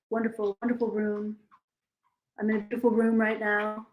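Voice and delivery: a hint of Trump bragging about the room; Trump impression. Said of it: "Are you ready for my Trump impression?".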